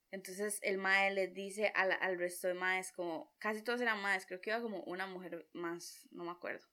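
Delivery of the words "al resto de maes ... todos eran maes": normal talking voice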